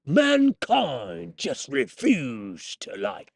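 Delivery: slow, drawn-out mock British Andrew Ryan; hammed-up British accent, stretched out. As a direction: put on a grand British villain voice, slowly. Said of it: "so I just went with the British accent".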